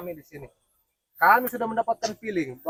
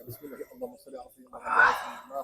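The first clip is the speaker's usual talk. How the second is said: drawn-out prayer; 5 semitones higher, much slower than usual. Acted. Slow prayer before spell.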